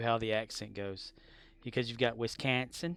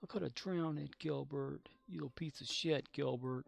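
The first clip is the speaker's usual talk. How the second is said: mock bitter muttering; 6 dB quieter than usual. Continues mock Gilbert scene, quieter.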